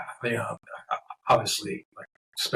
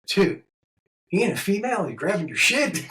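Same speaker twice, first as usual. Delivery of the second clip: raised incredulous retelling; 9 semitones higher than usual. Retold or imitated. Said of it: "I said".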